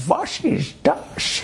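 slow, high, laughing mock German; mock German soldier. The soldier sees the rabbi walk in, mock German. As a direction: ham up a startled soldier.

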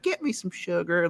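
high, soft imitation of a sweet woman. Host mimicking the smiling wife as she comes out, so sweet. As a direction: go small and high, mock-sugary. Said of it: "she's so sweet".

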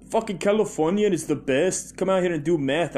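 put-on loud, high, botched celebrity accent drifting Irish; hammed-up movie star promo, pushed high and loud; Arnold Schwarzenegger. Movie star selling California in promos, loud botched impression. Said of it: "why he's got an Irish accent".

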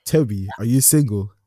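ordinary delivery

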